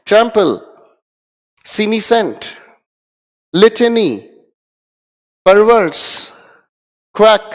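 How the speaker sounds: loud repeat-after-me drill. Shown as a demonstration. Teacher drilling vocabulary aloud with students, loud calls. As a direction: bark each word so the class echoes. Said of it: "मेरे साथ ऊंची आवाज में बोलने की कोशिश करें".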